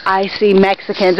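low-pitched, spooky quote delivery; mock-ominous movie line. Parodying "I see dead people" to a friend, mock-ominous. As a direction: intone it like a creepy confession. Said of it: "that's scary".

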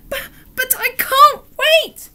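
high, loud, stammering whine; desperate little bear. Acted. Alan facing a long wait, desperate bear voice. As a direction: wail it in a panic.

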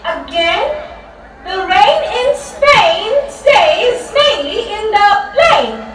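ordinary talk

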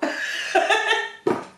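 rire aigu soufflé qui monte. Real, not acted. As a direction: rire sans voix. (rire aigu soufflé)

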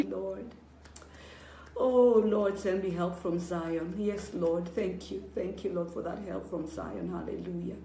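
real soft prayer, fading to a hush; whispered prayer aside. Real, not acted. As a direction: drop into a soft personal prayer. Breaks off to pray aloud.

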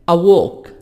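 أووك with rounded 'aw' vowel, British 'a wawk'; British pronunciation. Shown as a demonstration. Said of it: "بريتش".

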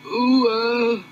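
high strained ooh uh groan; engine groan. Acted. Sixteen groans as he sinks, high strained groan. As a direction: groan as the rails give way. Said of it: "groaned sixteen".

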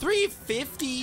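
high, drawn-out disbelieving question; incredulous. Real, not acted. Player reacting to the haggled speeder price, incredulous.

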